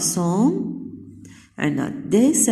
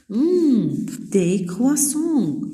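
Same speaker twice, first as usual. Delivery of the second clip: Julian savoring croissants; 6 semitones higher, much slower than usual. Acted. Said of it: "في عنا جوليان، il dit".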